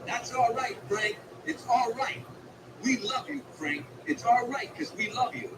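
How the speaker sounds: hollered encouragement. Retold or imitated. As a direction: holler up to the stage, rooting for him. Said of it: "he hollered out".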